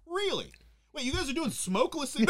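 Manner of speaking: high, eager mock agent; excited agent. FBI agent on the phone, high eager mock voice. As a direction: perk up like a thrilled FBI agent. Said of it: "The F B I is like".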